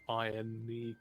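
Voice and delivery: ordinary talk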